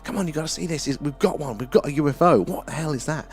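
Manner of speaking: high, urgent hushed calling; waking your wife to see a UFO; excited. Retold or imitated. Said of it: "called the missus".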